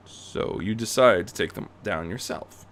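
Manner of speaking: plain reading voice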